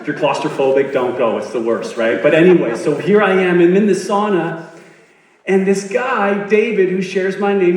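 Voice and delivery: ordinary delivery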